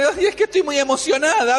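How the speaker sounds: very high, hammed-up thrilled wail; too thrilled to function, pitch soaring; overexcited Rode. Rode too excited to open for Peter, giddy. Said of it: "está tan emocionada".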